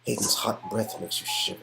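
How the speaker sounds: usual speaking voice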